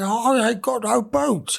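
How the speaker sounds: high, slow protest; put-on voice of a hapless mate; imitating a workmate. New starter telling his workmate about boots, imitated high protest. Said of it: "this is how he talks".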